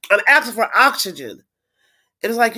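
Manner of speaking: ordinary talk, nothing marked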